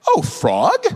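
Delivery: high, swooping pitch with a questioning rise; feigned innocent question. Acted. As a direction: play dumb, as if you misheard. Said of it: "what you do is I would say".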